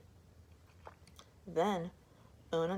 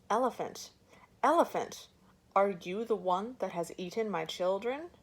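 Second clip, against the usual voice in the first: angry shout; 2 semitones higher, 4 dB louder than usual. Acted. Mother shouts angrily at the elephant who ate her children. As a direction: confront him, rising fury. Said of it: "she shouted angrily".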